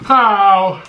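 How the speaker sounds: sharp high ow, loud; real pained ow, high and loud; pained yelp. Real, not acted. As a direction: yelp from the burn. Burning mouth from spicy noodles.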